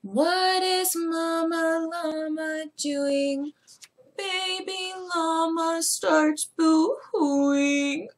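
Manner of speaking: lilting storybook reading; reading to children. Acted. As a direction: read it like bedtime story time. Reads the story aloud to children.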